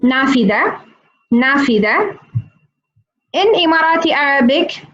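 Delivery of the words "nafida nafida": nafida with a clear f and a long final a; Fusha pronunciation. Shown as a demonstration. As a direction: repeat nafida carefully for the class. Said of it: "we say".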